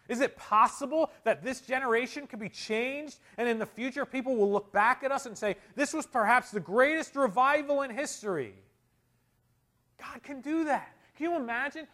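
high, loud, ringing exhortation; full-force preaching. Real, not acted. Preacher urging the church to ask God now, loud and high. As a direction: drive it home from the pulpit.

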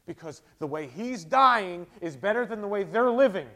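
loud, high, fervent preaching; preaching at full force. Acted. Pastor stirring the congregation, loud fervent preaching. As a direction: drive the point home to the congregation.

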